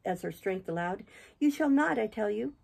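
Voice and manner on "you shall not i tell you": high first words, falling to a firmer close; acted woman's forbidding plea; insistent Catherine. A narrator's reading. Catherine holds on to her departing lover, high and insistent.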